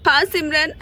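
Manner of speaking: normal talking voice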